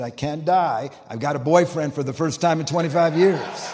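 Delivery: loud, exclaimed quote; emphatic protest. Retold or imitated. Woman tells her doctor she has a boyfriend, loud protest. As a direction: protest it loudly, building to a punchline.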